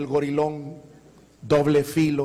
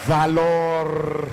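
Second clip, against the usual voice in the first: preaching emphasis; much slower than usual. Acted. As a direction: hammer Valor home from the pulpit. Preacher repeats key word.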